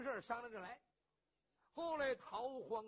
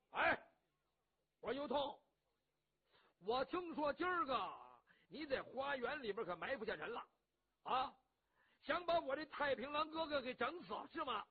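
louder, higher, challenging a host; rough hero accusing his host; 4 semitones higher, 6 dB louder than usual. Acted. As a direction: call him out before everyone. Cheng Yaojin confronts You Tong.

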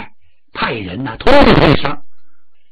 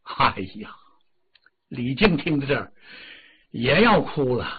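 usual speaking voice

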